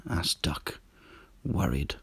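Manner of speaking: soft, breathy narrator aside; hushed narration. A narrator's reading. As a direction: drop to a whisper. Narrator whispers tag.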